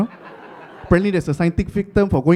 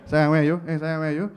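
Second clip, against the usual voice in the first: loveless tone; 4 semitones lower, faster than usual. Retold or imitated. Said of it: "the tone of no love in my voice".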